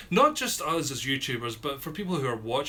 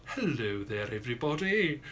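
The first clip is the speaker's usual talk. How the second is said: slow, enunciated greeting. Retold or imitated. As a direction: greet them as if enunciating every word for outsiders. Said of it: "you talk so slowly".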